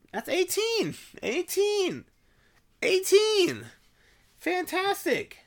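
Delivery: high, loud and escalating; real high-pitched outburst. Real, not acted. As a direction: pitch it up high and build the volume. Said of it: "talking in such a high-pitched voice".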